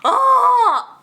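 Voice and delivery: loud, high, thrilled yell; real overjoyed cry; delighted shout. Real, not acted. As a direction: shout in gleeful disbelief. Reacting to the burn.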